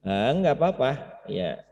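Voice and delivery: ordinary talk, nothing marked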